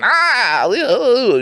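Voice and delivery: high mock wail; hammed-up high yelps of "ah" and "ooh"; overreacting. Man getting tattooed on video, overreacting with high yelps. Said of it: "you know, just overreacting".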